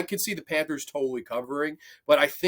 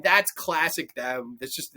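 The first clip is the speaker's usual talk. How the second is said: usual speaking voice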